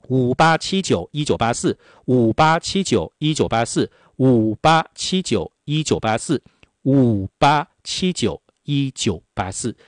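ad-read number, slowing to very deliberate; slowing hotline announcement. Acted. Hotline number repeated, slowing each time.

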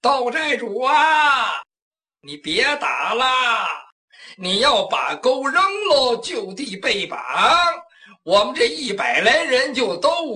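long drawn-out call across a battlefield; slow, stretched syllables, calling out for mercy; demonstrated pleading call. Acted. Henchman shows captives what to yell at the chief, drawn-out pleading call. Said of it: "就这么喊".

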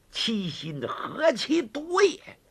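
high, slow, contemptuous line; scathing condemnation. Acted. Woman denouncing Gao Feng's betrayal, slow and scathing. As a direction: drag it out in disgust.

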